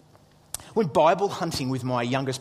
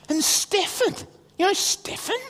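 put-on much higher eager kid voice; excited son. Son returns to tell Dad about Stephen, eager high voice. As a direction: imitate a boy with more news, pitched up. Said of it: "he comes back about an hour later and he goes".

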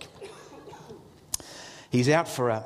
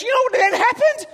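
put-on very high, loud kid voice; mock thrilled question; excited son; 7 dB louder than usual. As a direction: imitate a boy eager to tell more. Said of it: "And then he goes".

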